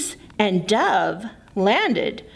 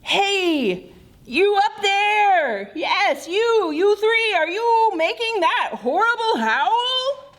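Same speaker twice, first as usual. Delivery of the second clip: higher call to the treetop; 8 semitones higher than usual. Acted. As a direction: shout up to the animals above. Moose calling up the tree.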